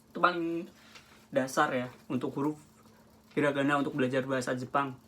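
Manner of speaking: ordinary delivery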